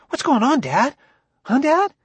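put-on very high, eager boy; thrilled squeaking. A narrator's reading. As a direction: pester dad with excited questions. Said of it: "squeaked Ben in a sort of horrified pleasure".

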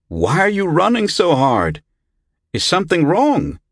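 high-pitched questioning yell. A narrator's reading. Villagers yelling to a running boy, yelled out. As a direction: call out high after a running child. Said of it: "they yelled out".

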